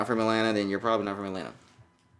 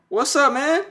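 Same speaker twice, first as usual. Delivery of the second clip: much higher, louder sing-song greeting; 6 dB louder, slower than usual. Real, not acted. Greeting his young son on FaceTime.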